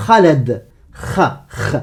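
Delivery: "Khaled", "Kha", "kh", each with a rasped onset; guttural kh demo. Shown as a demonstration. Teacher breaks the name down, "Khaled" to bare throaty "kh". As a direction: scrape the kh in "Khaled", then alone. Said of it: "Pour représenter ce son justement".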